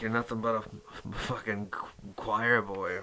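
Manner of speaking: ordinary talk, nothing marked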